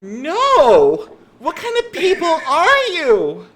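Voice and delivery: very high, loud shriek of outrage; appalled outburst. Acted. Girl recoils from her rescuers, loudly appalled. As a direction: recoil and yell it in shocked indignation. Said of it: "She takes a step back".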